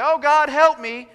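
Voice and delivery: slow, loud cry for help; desperate plea. Retold or imitated. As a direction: cry out to God slowly and loudly. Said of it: "We say".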